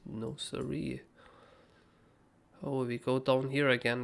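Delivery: ordinary delivery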